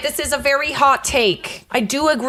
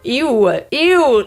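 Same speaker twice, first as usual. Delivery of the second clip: ew, the second one pitched up louder; disgusted ew; 4 dB louder than usual. Real, not acted. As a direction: recoil in disgust.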